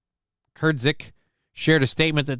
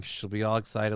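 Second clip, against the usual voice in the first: ordinary delivery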